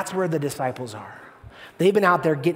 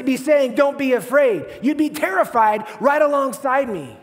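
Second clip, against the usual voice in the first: higher, worked-up objection; exasperated protest; 7 semitones higher, 4 dB louder than usual. Acted. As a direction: argue back, raised pitch and rising frustration. Voices a listener protesting to Jesus.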